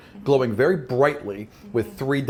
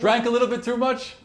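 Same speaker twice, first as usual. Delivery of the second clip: sailor shouting; 9 semitones higher than usual. Acted. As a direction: shout over to the crew, concerned. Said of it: "someone is shouting in the back of the ship".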